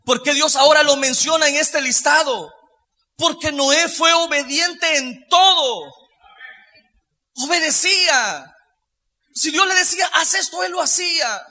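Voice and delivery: high, loud, ringing pulpit voice; full-force preaching. Real, not acted.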